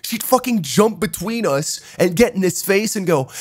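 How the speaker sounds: normal talking voice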